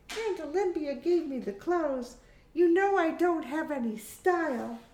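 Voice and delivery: put-on high, girlish character voice; Hamish, young daughter.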